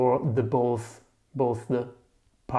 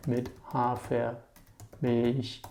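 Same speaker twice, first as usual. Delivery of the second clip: "Mit Hafermilch" very slow, softer; slowed-down repeat; 5 dB quieter, much slower than usual. Shown as a demonstration. Teacher repeats the coffee order for learners, very slowly.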